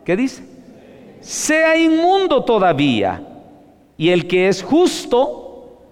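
high, slow, emphatic scripture reading; preaching a verse. Acted. Preacher reading the verse to the congregation, high and slow. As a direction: proclaim each line of the verse.

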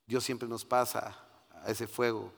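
usual speaking voice

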